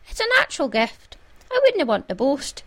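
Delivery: high, coy self-deprecation; modestly pleased with himself; false modesty. A narrator's reading. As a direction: brush off praise in a lifted voice. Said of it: "waving his hands modestly".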